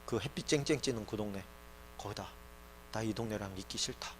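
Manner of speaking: low, very soft refusal; quietly withdrawing God. Acted. God sends the tabernacle outside the camp, quietly withdrawing. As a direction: drop low and hushed, as God. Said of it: "하나님께서 그렇게 얘기해요".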